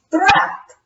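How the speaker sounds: high annoyed exclamation; frustrated vampire. Acted. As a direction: bite it off in annoyance.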